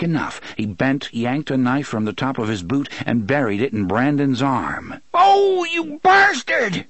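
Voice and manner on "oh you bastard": high, drawn-out pained yell; loud high cry of pain; shout of surprise and pain. A narrator's reading. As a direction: yell it out slowly as if just stabbed. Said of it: "Brandon shouted in surprise and pain".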